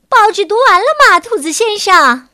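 loud, high, quick shout; 大声喊. A narrator's reading. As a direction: call out loudly to someone downstairs. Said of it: "还会冲着楼下大声喊". (冲着楼下大声喊)

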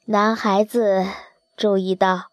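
plain reading voice

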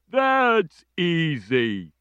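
slow, lightly voiced friendly dinosaur; a gentle hint of a big dinosaur. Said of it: "said the dinosaur".

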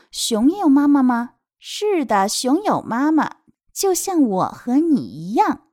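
put-on lilting question and answer for children; picture-book reading. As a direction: read it out expressively to little ones. (有起伏地给孩子读绘本)

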